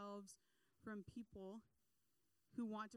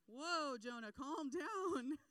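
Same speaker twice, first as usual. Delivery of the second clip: mock alarmed; 4 semitones higher, 8 dB louder, much slower than usual. Sailors calming Jonah.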